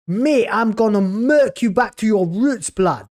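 lightly voiced London street accent, mocking the actor; mock London slang accent. Mocking the Doctor actor's delivery in a light London street accent. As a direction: parody the actor with a hint of London roadman. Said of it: "He sounds sort of half Chev, right?".

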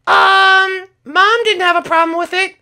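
put-on very high kid character voice; Skittles voice. Skittles explaining his crash to Dad, very high kid voice. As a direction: chirp it like an eager young son.

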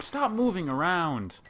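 loud, high, drawn-out demand; frustrated yell. Real, not acted. Player to a dodging enemy, in exasperated yelling. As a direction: wail it at a target you keep missing.

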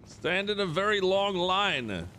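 high, drawn-out mock order; slow pretend parade-ground call; mock drill command. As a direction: stretch it out like a silly officer.

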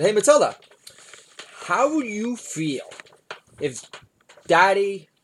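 put-on butter up the kid; sugary parent pitch voice; coaxing dad voice. Dad sweet-talking kid voice.

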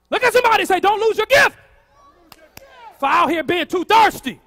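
loud, high preacher's shout; rousing exhortation. Real, not acted. Preacher telling congregation what to say to neighbours, rousing exhortation. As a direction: call it out for everyone to repeat.

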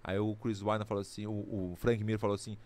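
ordinary talk, nothing marked

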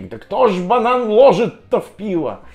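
high, loud, indignant mocking question; mocking commenter. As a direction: scoff at it like a clueless commenter. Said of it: "раньше писали в Яндекс.Дзене".

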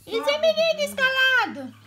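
very high, loud cooing voice. Real, not acted. Vlogger cooing at her cat Mimi in a high baby voice. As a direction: squeal it like talking to a kitten.

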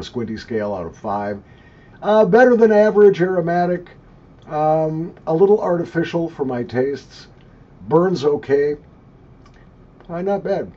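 talking around pipe stem; pipe in mouth. Real, not acted. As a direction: lips clamped on pipe. Seen 4: Long-stemmed pipe held between lips while speaking throughout.

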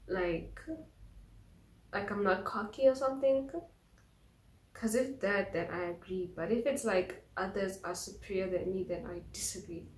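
speaking through fingers; fingers on lips. Real, not acted. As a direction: hand partly covering mouth. Seen 2: fingers touch and partly cover lips throughout the labelled words.

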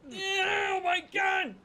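high, drawn-out wailing cry; despairing wail. Real, not acted. Wailing after a fall.

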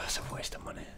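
breathy whispered aside under breath. Real, not acted. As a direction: whisper it to yourself. Whispered aside, then repeated plainly.

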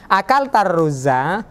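"akal tarruza" rising at the end as a question; questioning rise on "tarruza"; question intonation. Shown as a demonstration. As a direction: ask "akal tarruza" with a lift on the last syllable. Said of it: "Antum kalau mau panjang bisa".

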